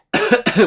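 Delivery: sharp real cough; a sudden hacking cough mid-sentence; coughing fit. Real, not acted. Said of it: "I'm sorry I'm coughing so much".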